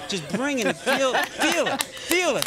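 pumped-up hype. Acted. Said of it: "When you get that bucket poured on you, right? Say".

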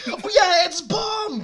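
imagined cabin crew shouting over a walkie-talkie; mock crew radio call. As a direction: yell it like a doomed crew member on the walkie-talkie. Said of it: "I just imagine, like, the walkie-talkie".

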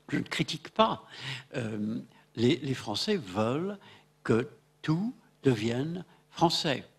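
ordinary delivery

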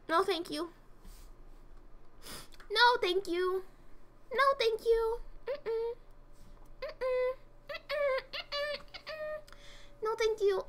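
a prissy little voice that climbs with each no; squeaky refusal. Pretend. Streamer turning down an unwanted item in a rising, prissy voice.

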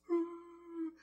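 high, drawn-out wail; imitated sob. Preacher reliving hearing the phone message, high mock wail. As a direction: act out breaking down in a high moan. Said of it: "Just tortured my emotions".

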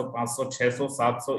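ordinary delivery

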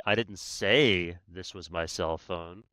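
slow take stressing say. Shown as a demonstration. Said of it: "Or I could say".

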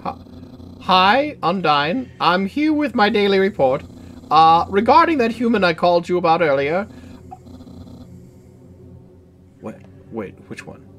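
lightly voiced eager skeleton; a hint of a loud, eager Papyrus.

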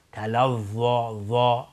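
slow تلظی with thick voiced th; tongue-tip za'. Shown as a demonstration. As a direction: isolate dark interdental ظا. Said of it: "نوک زبان باید بیرون بیاد".

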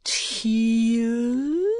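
high, drawn-out exclamation; loud cry. A narrator's reading. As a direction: cry it out, stretching the word. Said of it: "cried the old gentleman".

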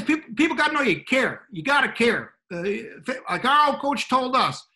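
high, loud hammed-up Southern football coach; bad Southern accent coach. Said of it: "I gave him a Southern accent, uh, a really bad one".

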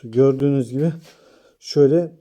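ordinary talk

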